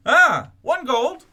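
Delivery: loud high hawking cry, stretched out; newsboy call. Acted. Newsboy hawks the Asari Sun to passersby, loud call. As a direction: cry the headlines to the street like a paperboy.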